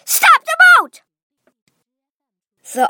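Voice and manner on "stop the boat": a very high, loud command; sudden shout. Acted. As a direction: yell it to halt everyone at once.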